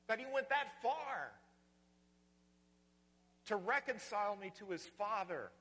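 loud, high, fervent preaching; full-force preaching. Real, not acted. Pastor to congregation, soaring in loud, awed preaching. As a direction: preach it out with rising awe.

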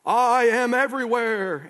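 high, loud, drawn-out proclamation; mysterious great voice. Acted. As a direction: intone it like an unseen, all-powerful voice. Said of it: "answered the voice".

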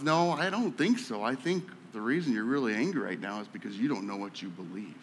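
calm friend calming an angry classmate; tender friend. Retold or imitated. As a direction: speak it quietly with love. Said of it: "He goes".

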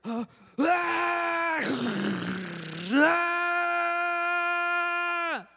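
high, loud scream of panic; retold scream. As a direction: yell out in fright as you crash. Brother hears a crash scream.

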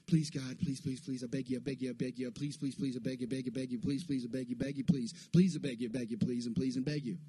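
low, rapid-fire run of pleas; imitated begging prayer. Preacher mimicking his own desperate prayers, nonstop begging. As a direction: beg without stopping, words tumbling over each other. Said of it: "I prayed like that".

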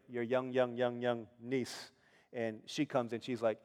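ordinary talk

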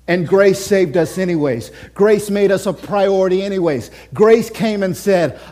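ordinary delivery

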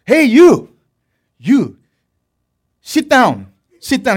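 father orders youngest son to sit; shouted order in accent. Retold or imitated. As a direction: yell and point at the little brother. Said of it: "he's like".

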